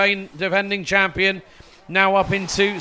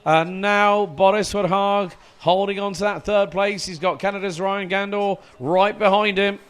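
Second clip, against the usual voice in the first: excited, raised race play-by-play; motorsport commentator; 3 semitones higher, 3 dB louder than usual. Acted. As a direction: call the race live and keen. Live race play-by-play, pre-final start.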